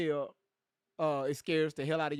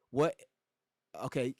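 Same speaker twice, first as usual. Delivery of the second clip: normal talking voice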